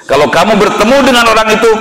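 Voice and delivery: harsh forceful quote. Retold or imitated. Preacher quoting a harsh command against sinners, forceful. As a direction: deliver the command harshly and urgently. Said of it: "bahasanya kasar tidak ini".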